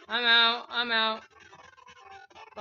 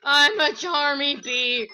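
loud, slow proud announcement; excited declaration; 4 semitones higher, 11 dB louder, slower than usual. Real, not acted. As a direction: proclaim it like a triumphant cartoon bee. Declaring himself Charmy.

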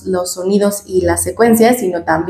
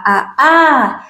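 last "a" in "a, a" stressed and higher; stress at the end; 5 semitones higher than usual. Shown as a demonstration. Therapist imitating the child's final stress, higher. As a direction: put the accent on the final "a" in "a, a". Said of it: "por qué haces el acento al final".

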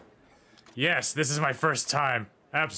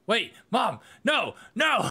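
panicked plea; 9 semitones higher, 4 dB louder, slower than usual. Acted. Son panics as mother leaves.

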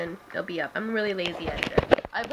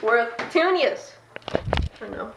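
ordinary talk, nothing marked

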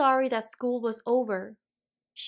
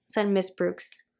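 ordinary delivery, nothing marked

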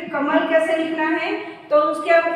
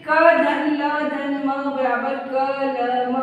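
slow, each syllable of "क धन ल धन म" drawn apart; slow sounding out; much slower than usual. Shown as a demonstration. Teacher modelling how pupils should read the word, slowly. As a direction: spell it out patiently for a class. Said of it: "हम इसको पढ़ेंगे कैसे?".

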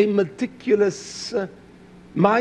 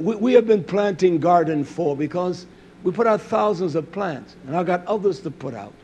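ordinary talk

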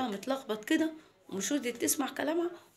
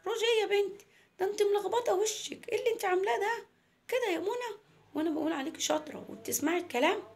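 high-pitched grandma telling off; scolding grandma; 9 semitones higher than usual. Acted. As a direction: tell off the granddaughter in a raised pitch. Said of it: "قالت لها". (تيتا بتوبخ حفيدتها)

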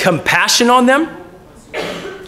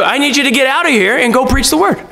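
ordinary delivery

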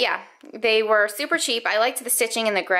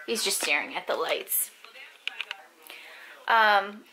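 usual speaking voice

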